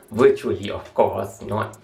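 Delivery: ordinary talk, nothing marked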